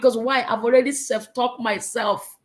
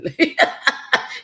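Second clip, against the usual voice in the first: high, airy giggling laugh; real laugh; 5 semitones higher than usual. Real, not acted. Speaker after praying not to yell, giggling laugh. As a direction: break into giggles at your own confession.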